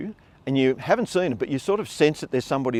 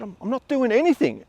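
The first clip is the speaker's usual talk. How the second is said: flustered denial; 8 semitones higher than usual. Retold or imitated. Defending himself to the boss.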